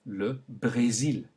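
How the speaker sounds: slow le Brésil with closed é; le Brésil slowly; French é. Shown as a demonstration. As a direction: stretch the é in Brésil. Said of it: "Note the kind of E sound".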